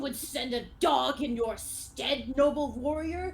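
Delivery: scornful challenger, building to a loud cry; proud duelist taking offence; indignant knight. Acted. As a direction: rise to an outraged proclamation. Game master plays the skeleton knight.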